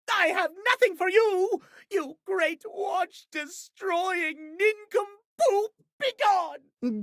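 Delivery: high, shouted dismissal; indignant shout. Acted. Offended watch driving off the one who broke watches, angry shout. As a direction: banish the oaf with outrage.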